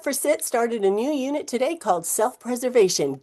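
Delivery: usual speaking voice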